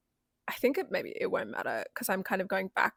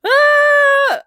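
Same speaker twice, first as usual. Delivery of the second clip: delighted scream; 17 dB louder than usual. Real, not acted. Squeal of delight.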